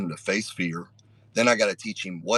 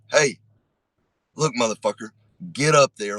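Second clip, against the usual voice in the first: firm, raised aggressive command; put-on anger, pitched up to push someone on; mock-angry order; 4 semitones higher, 4 dB louder than usual. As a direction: act mad without really being mad. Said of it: "will be more aggressive".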